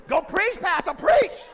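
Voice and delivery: loud, high call urging the pastor on; amen-corner shout to the pastor; cheering congregant. Retold or imitated. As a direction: hype the preacher up from the pews. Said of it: "mimicking cheering congregation".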